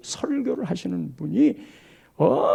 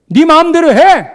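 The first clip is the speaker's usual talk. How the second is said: higher and louder, fed-up snap; exasperated mother; 8 semitones higher, 14 dB louder than usual. Retold or imitated. Mother gives up on her stubborn child, shouting. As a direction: throw up your hands at the child.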